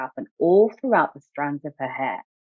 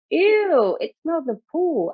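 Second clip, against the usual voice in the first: put-on higher, childlike disgusted exclaim; child's high "ew" of disgust; 12 semitones higher, slower than usual. A narrator's reading. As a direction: squeal it out like a grossed-out little girl. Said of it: "Ada exclaimed".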